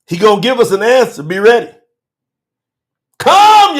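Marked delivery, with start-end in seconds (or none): 3.2-3.8 high opening cry, falling away; yelled call. Real, not acted. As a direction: call out to the Lord. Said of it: "we yell at him right now".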